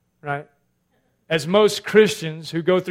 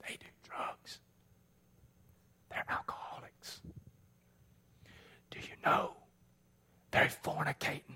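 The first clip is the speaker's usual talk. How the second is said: gossipy whisper; 3 semitones lower, 18 dB quieter than usual. Retold or imitated. Self-righteous churchgoer gossiping about sinners, whispered.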